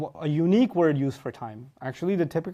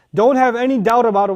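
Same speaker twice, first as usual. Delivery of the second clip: loud, raised, insistent declaration; forceful assurance; 7 semitones higher, 9 dB louder than usual. Acted. Speaker voicing what inna tells doubters, loud and insistent. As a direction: shut down all doubt, loudly. Voices meaning of emphatic particle.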